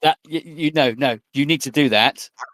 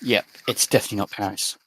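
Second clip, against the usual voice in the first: sniffing the air; 4 semitones lower, 5 dB quieter, faster than usual. Acted. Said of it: "I'll sniff the air and say".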